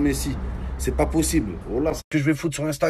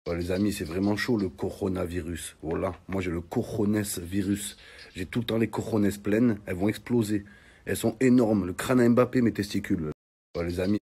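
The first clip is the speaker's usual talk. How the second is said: mock sick voice; 5 semitones lower, 6 dB quieter than usual. Mock sick person on coronavirus.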